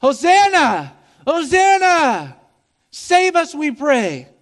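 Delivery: loud, high, drawn-out Hosanna cry; crowd crying Hosanna. Retold or imitated. Preacher voicing the crowd at Jesus' entry, loud high cry. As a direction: call out like a hopeful crowd. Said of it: "they cried".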